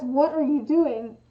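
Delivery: slow, deliberate demo of an angry question; accusing. Shown as a demonstration. Showing that the full question implies anger, accusing. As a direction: separate each word with mild reproach. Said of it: "almost implies, like, anger".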